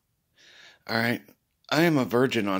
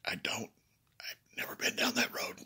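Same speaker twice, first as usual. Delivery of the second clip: soft breathy whisper, much quieter; whispered confession; 9 dB quieter than usual. Real, not acted. Confessing to Deb he has never eaten healthy, whispered. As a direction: admit it under your breath.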